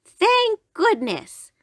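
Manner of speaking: high, very drawn-out granny voice; slow, high, grateful old lady; relieved grandmother. A narrator's reading. Grandmother relieved the wolf is gone.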